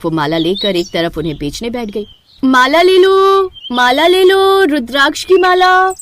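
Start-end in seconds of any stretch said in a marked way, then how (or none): 2.4-5.9 loud, high, drawn-out seller's call. Acted. Girl hawking rosaries at the crowded fair, loud high call. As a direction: call out to passing buyers in the fair.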